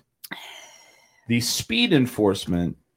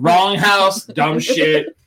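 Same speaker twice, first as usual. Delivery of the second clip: mock yell; 4 semitones higher, 5 dB louder, much slower than usual. Imitating Holly's outrage.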